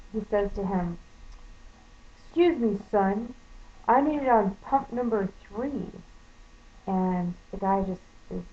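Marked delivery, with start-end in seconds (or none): 2.2-5.9 imitating a condescending customer, loud and pointed. Older customer orders the gas station clerk around, condescending. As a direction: talk down to him, higher and louder. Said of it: "just the most condescending tone".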